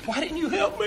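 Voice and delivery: very high mock wail; imitated crying. Crying boy blames the man after the crash, high mock wail. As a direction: wail it like an upset little boy. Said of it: "he's crying".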